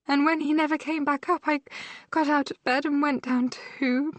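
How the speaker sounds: injured sister telling her sister about the night of the attack, distraught; distressed sister, recounting. A narrator's reading. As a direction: relive the horror as it breaks her.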